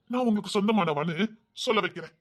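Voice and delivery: normal talking voice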